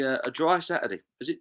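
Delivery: usual speaking voice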